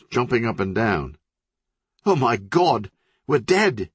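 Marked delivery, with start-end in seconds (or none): 2.0-3.9 high, frantic panic; panicked. Acted. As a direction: squeal it out in terror, rising. City jumping up and down.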